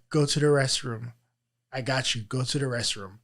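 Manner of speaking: soft, low murmur of a friend; retold whisper. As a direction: hush it gently like a colleague leaning in. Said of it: "she whispered in my ears".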